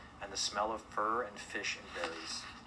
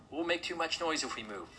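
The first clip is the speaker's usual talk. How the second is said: high-pitched urgent warning, not shouted; commanding warning; 8 semitones higher than usual. A narrator's reading. Mister Hartwood warns animals to stay still, high urgent warning. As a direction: warn them in a raised-pitch character voice. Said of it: "commanded Mister Hartwood".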